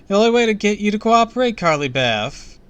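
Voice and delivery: high, loud rush that slows on the name; menacing woman forcing cooperation; villain's insistent declaration. A narrator's reading. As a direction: declare it forcefully, then stretch out the name. Villain Laura reveals her scheme.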